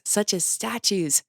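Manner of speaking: usual speaking voice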